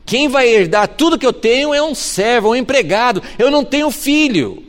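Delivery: Abraham pleading high and loud. Acted. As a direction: lay your complaint before God, pitched high. (Abraão suplicando a Deus, voz alta e aguda)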